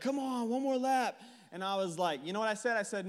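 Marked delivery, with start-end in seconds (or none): 0.0-1.2 much higher, pushy coach yelling a drill; a lightly voiced coach pushing one more lap. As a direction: egg the runner on like a coach. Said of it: "my coach was like".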